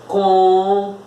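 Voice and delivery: "computador" in four held pieces, COM... PU... TA... DOR; syllable by syllable. Shown as a demonstration. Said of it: "Falando, ó". ("computador" separado devagar, como quem conta nos dedos)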